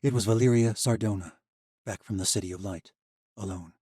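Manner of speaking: ordinary delivery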